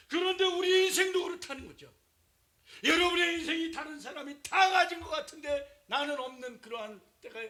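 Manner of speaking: high, loud shouted sermon; fiery preaching. Real, not acted. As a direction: hammer it home to the congregation. Preaching at full force.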